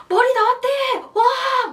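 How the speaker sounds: loud, high, whooping announcement; reenacted delivery shout. Woman announcing baby's head to absent husband, reenacted shout. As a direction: shout the news out with a big cheer. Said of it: "큰 소리로 막 진통할 때마다".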